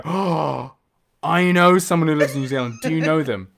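lightly mimicked excited stranger; a touch of loud, eager surprise; eager recognition. Stranger meets someone from New Zealand, imitated eager recognition.

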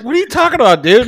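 high-pitched, baffled dad; retold incredulity. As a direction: squeak out amused disbelief at the kid. Dad incredulous at son's retort.

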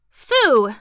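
a loud scornful snarled Phoo!. A narrator's reading. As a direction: spit Phoo! out in loud disgust. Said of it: "snarled the kitten".

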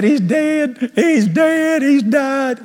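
high-pitched mock wail; sobbing wife. Hysterical wife telling her husband of a death, mock sobbing. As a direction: imitate a distraught wife, high and wailing. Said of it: "she just sobbing".

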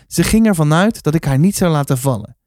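ordinary delivery

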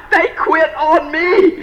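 high, drawn-out mock indignation; mock outrage. Speaker to crowd about people who quit, mock outrage. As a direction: wail it slowly, playing the wronged leader.

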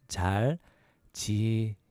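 잘 지내요 slowly, syllables apart, rising at the end; slow syllable breakdown. Shown as a demonstration. Teacher breaking down "how are you", slow syllables. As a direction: space out 잘 지내요 as a question. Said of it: "A little faster".

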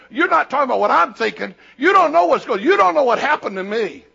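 imitating an aggrieved objection, high and loud; indignant listener. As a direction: push back loudly, as the aggrieved listener. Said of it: "right now you're saying".

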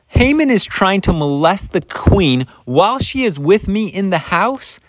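high, outraged royal accusation; high-pitched mock outrage; indignant accusation. King accuses Haman, acted out.

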